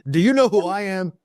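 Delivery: loud, high-pitched mock outrage; mock indignation. Listener joking as the offended boss, mock indignant. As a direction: play the offended big shot for a joke.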